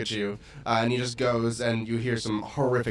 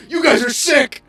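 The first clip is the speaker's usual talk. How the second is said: loud, high-pitched cry of outrage; outraged shout; 14 semitones higher, 9 dB louder than usual. Acted. Game master voicing the dart victim to the players, outraged shout. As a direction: shout it out in shock at the attackers. Voices dying man in the story.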